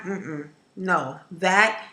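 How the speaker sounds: ordinary delivery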